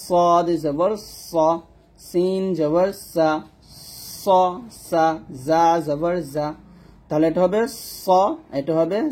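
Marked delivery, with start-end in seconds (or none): 0.0-6.5 slow, long hissing স, সা and buzzing জা; whistling sibilants. Shown as a demonstration. Teacher demonstrates the three safir letters, whistling. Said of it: "সবসময় শীষ দিয়ে উচ্চারণ করব". 8.0-8.0 স with a long whistled s, rounded o; whistling so. Shown as a demonstration. Teacher points out sod, whistling so. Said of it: "শীষ দিয়ে উচ্চারণ করব".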